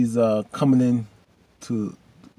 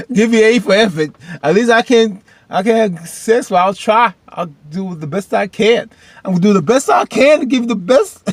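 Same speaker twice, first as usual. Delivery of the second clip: very high, loud, hammed-up declaration; comic full-voiced yelling, much higher; mock enthusiastic shouting; 11 semitones higher, 12 dB louder than usual. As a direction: shout it like an over-eager promise. Mock cheering of a character's eager line.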